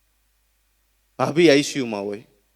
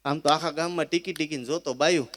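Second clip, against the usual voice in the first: ordinary delivery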